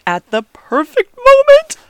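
high, loud, drawn-out suspense; excited build-up. Real, not acted. Fan building up to the flyover, thrilled. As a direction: stretch it out high and loud with awe.

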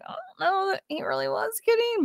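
high, mock-doubtful voice; skeptical inner voice. Podcaster voicing her doubt about the general, high mock voice. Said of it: "But I was like".

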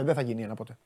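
low, quiet mocking echo; mock muttered echo. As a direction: repeat «δεν θα γίνει ένα ποτέ» under the breath, mocking. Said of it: "Ρε εσείς".

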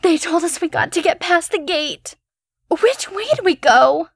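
put-on high, frantic frightened girl; panicked young woman. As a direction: rush it out in fear. Panicked girl finds her friend.

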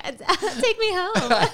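high, slow, laughing puppet voice. Retold or imitated. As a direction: drag it out like a small puppet, laughing. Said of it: "him saying".

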